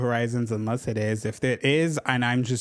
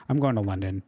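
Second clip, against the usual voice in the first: a light British accent, lower; 4 semitones lower, faster than usual. Shown as a demonstration. Telling viewers her trip plans in a British accent. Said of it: "Was that a good British accent?".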